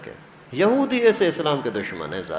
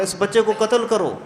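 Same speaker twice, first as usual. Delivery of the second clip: raised, higher mock yell of a soothsayer; retold shout, pitched up; soothsayer's shout; 6 semitones higher than usual. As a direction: call out the order to kill, half shouted. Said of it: "آواز زور سے چلا کر کے بولا".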